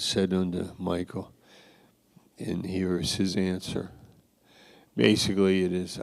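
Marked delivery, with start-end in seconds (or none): none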